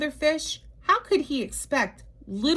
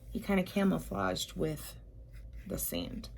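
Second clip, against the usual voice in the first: whispered aside about the sand; soft unvoiced whisper to listeners; 4 semitones lower, 10 dB quieter than usual. Real, not acted.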